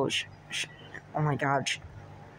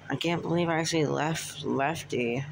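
ordinary talk, nothing marked

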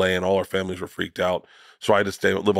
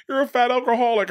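much higher mock daughter voice, blunt; mimicking daughter. Daughter bluntly calling her dad a fat alcoholic, in a much higher mock voice. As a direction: pitch way up and deliver the insult deadpan like a kid. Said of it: "She's like".

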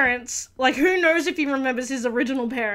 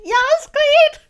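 loud high cheer; loud high fan cheer; 13 semitones higher, 8 dB louder than usual. Real, not acted. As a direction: hype her up like a fan. Cheering on a character.

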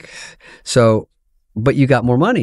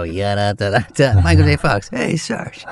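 lazy stand-up set; 3 semitones lower than usual. Retold or imitated. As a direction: toss off tired old jokes. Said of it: "I would go on and go".

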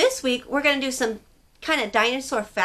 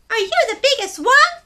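high, loud curious little penguin voice; a tiny penguin full of questions; 13 semitones higher, 8 dB louder than usual. Acted. Story reader voicing small penguin Pipkin asking big questions. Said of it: "asked Pipkin".

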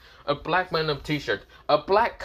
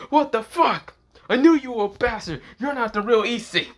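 outraged shout; 11 semitones higher, 4 dB louder than usual. A narrator's reading. Dragon outraged at the impostor.

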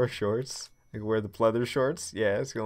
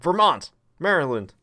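high, loud mock yell; playful shouting of state names; 8 semitones higher, 8 dB louder than usual. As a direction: blurt the states out as guesses. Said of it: "I just start yelling out".

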